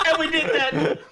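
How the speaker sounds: laughing hard while talking; breaking up with laughter. Real, not acted. Laughing at the memory.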